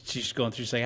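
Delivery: ordinary delivery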